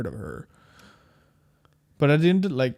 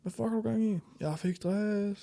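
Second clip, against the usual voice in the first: movie quote; 7 semitones higher, 9 dB quieter than usual. Retold or imitated. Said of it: "quoting the movie scene".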